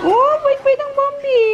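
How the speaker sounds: very high, slow coo. Real, not acted. Owner praising her sweet dog Bambi in a slow, high coo. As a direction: croon praise to a good puppy.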